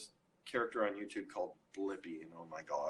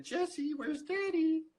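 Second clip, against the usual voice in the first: much higher coaxing parent voice, asking twice. Retold or imitated. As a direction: coax a toddler to point out her dad. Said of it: "And then".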